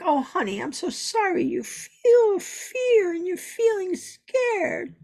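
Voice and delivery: high, slow, syrupy comfort; sugary pretend comfort the master never gave; mock sympathy. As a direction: coo like a pretend soothing mother. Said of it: "No compassion. No, like".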